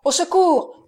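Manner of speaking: high, loud panicked shout; the count rushing out calling servants, high and loud; cry for help. A narrator's reading. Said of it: "en criant".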